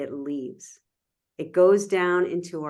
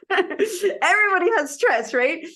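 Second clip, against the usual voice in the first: high, loud laughing speech; talking over a laugh, pitch way up; 11 semitones higher, 6 dB louder than usual. Real, not acted. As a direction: say it through real laughter. Laughing at own joke.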